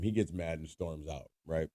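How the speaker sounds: usual speaking voice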